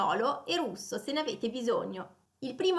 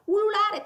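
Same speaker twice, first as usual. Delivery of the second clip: howling; 9 semitones higher, 6 dB louder than usual. Shown as a demonstration. Mimics howl in the verb.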